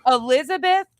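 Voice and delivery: high, loud call across the room. Real, not acted. Calling [name] over to see the photo, loud and high. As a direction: summon her from the other room.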